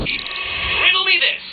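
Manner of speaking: high, loud and slow showman's call; booming villain flourish; theatrical riddle intro. Acted. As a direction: relish the catchphrase.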